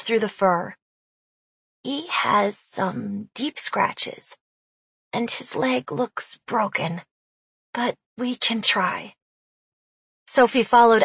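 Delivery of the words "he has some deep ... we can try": soft, very slow and careful; gentle. Acted. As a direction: speak gently over the wounded animal. Adeline examines hurt creature gently.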